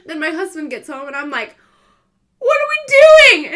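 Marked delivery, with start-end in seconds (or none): 2.4-3.5 high, loud exasperated wail; what are we doing, shrieked; panicked cry. Retold or imitated. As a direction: throw up your hands in panic. Panicked question to husband.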